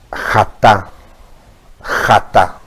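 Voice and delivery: jatá with a throaty Hebrew het, xa-TA; jatá said slowly with a guttural het, stress on the last syllable. Shown as a demonstration. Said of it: "se dice con het, que es gutural".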